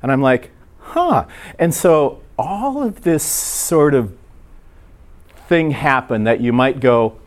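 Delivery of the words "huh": a sharp high-pitched huh of surprise; a startled, squeaky grunt; surprised huh. Retold or imitated. Pastor caught off guard by a joke, surprised huh.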